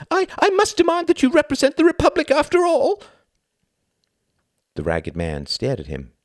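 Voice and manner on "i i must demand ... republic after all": very high, loud stammering plea; terrified wail. Acted. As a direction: beg for your life under the gun. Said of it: "wailed in terror".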